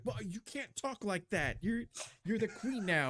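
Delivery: high, flustered scolding; flustered advisor. Acted. Flustered advisor scolds the queen's rude entrance, high and sputtering. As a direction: sputter at her, scandalized. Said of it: "red in the face, and he's like".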